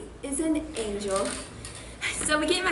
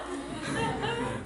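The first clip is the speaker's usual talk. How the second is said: high giggle; 9 semitones higher, 7 dB quieter than usual. Real, not acted. After the crazy robbery line.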